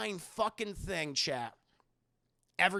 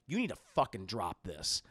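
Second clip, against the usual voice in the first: low, stern warning; really serious; 8 semitones lower than usual. Retold or imitated. Mayor warning Agatha off, really serious. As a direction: drop the pitch and mean it. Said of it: "he gets really fucking serious".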